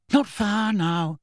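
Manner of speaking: high, drawn-out call; mild shout, stretched out; shouted reply. A narrator's reading. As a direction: call it back slowly, pitched high. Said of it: "shouted Hermione".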